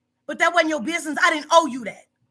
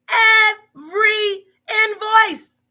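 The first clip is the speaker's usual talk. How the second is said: slow, strained, higher cry; voice cracking; 7 semitones higher, 7 dB louder, much slower than usual. Real, not acted. Boss hurt by worker's accusations, voice cracking. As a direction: let the voice break on it.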